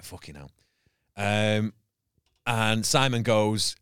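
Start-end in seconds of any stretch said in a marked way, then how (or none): 0.0-0.5 much softer, barely voiced curse; muttered under breath. Comedian seething silently at the judges, cursing under his breath. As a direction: swear quietly to yourself.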